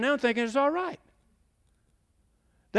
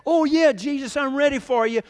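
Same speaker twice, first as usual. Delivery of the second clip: louder, higher show of eagerness; mock eager believers; 5 semitones higher, 5 dB louder than usual. Preacher mimicking those making commitments, mock eager. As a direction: imitate keen new converts, raised voice. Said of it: "ones who are making a commitment today".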